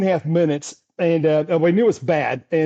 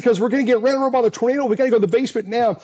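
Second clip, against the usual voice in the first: urgent rush; 6 semitones higher, much faster than usual. Retold or imitated. Urging homeowner to basement.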